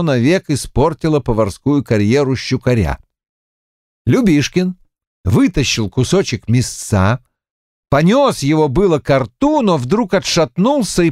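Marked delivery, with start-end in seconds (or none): none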